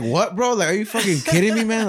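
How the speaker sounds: high-pitched mock outrage; incredulous outburst. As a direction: act out an exasperated "are you serious". Acted outraged reaction to a claim.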